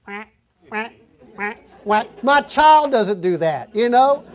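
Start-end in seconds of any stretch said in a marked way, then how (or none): 0.0-2.2 duck quacks. Retold or imitated. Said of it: "this duck going".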